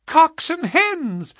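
put-on very high, drawn-out child's squeal; piping little girl thrilled by birds; gleeful cry. A narrator's reading. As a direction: call it out in delight. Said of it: "cried the little girl gleefully".

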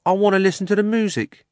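high, loud, quick cabby voice; fast, exasperated cabby; insistent cabby. Acted. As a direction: protest loudly over the others. Cabby insists on hearing music.

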